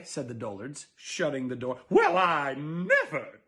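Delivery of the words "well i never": slow, louder indignant huff; hammed-up huffy mutter. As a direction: huff as she stomps off offended. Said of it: "neighbor lady muttering".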